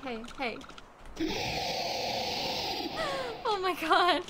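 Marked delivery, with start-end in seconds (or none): 0.6-3.4 laugh. Real, not acted. Streamer greets chat, laughs.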